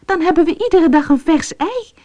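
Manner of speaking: high, joyful cry; overjoyed at the thought of fresh eggs; happy exclaim. A narrator's reading. Fatima answers her husband, overjoyed. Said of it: "zo blij dat ze riep".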